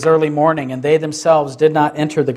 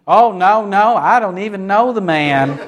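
mock Southern accent; 4 semitones higher than usual. Peter as a Southerner denying Jesus, exaggerated Southern accent. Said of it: "had come from Mississippi or Alabama".

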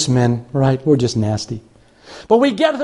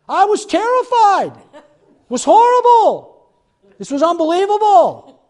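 comic terror; 11 semitones higher than usual. Retold or imitated. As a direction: wail each word in hammed-up dread. Comic outburst about scary ride.

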